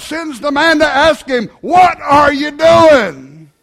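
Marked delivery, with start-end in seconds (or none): 1.6-3.5 loud, slow demand; preacher calling the church to account; booming challenge. Real, not acted. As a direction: thunder the question at the congregation.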